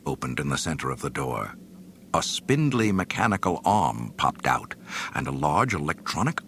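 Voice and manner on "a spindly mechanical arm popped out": usual speaking voice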